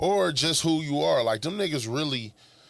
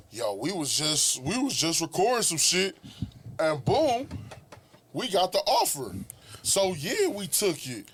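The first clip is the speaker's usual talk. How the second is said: higher-pitched mock naive voice; lightly acted, pitched up innocent retelling; naive hoopers; 5 semitones higher, slower than usual. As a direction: lightly play someone who jumped at the offer. Voicing naive hoopers who took offer.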